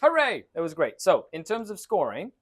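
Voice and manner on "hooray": loud high hooray; cheer. Real, not acted. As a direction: celebrate loudly. Mock celebration of survival.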